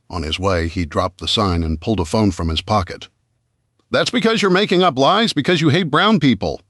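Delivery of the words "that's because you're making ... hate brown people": high, loud accusing shout; furious protester shouting; angry shout. A narrator's reading. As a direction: hurl the accusation at him. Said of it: "a woman shouted".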